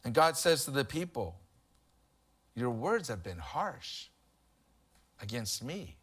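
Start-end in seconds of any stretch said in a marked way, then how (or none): 2.6-6.0 hurt and pleading. Acted. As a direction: speak low and hurt as God grieving. God pleads hurt with people.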